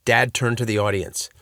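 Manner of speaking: ordinary delivery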